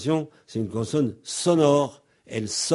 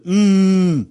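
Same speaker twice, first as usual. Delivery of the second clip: mm hummed higher and louder; 7 semitones higher, 7 dB louder than usual. Shown as a demonstration. Teacher making the vocal-cord buzz heard, loud. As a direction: hum mm strongly. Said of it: "pendant que je fais le B".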